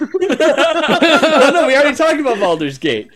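high, loud laughing protest. Real, not acted. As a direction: wave it off mid-laugh. Laughing at friend's interruption.

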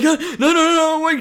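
high, loud panicked no; frantic pleading, laughing. Retold or imitated. Panicked owner quote.

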